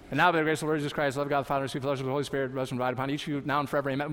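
very fast, run-together liturgical blessing; a blessing gabbled at top speed; rattled-off benediction. Retold or imitated. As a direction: race through it like the pastor did. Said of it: "We could say it that fast".

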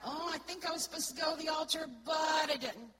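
blurting out a regret too late; regretful outburst. Acted. A churchgoer regretting skipping the altar, loud outburst. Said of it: "This is when we hear that".

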